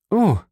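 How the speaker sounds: high loud cry of relief. Acted. Mercedes cries out as her son is spared. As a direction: cry out with sudden joy. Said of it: "rief sie".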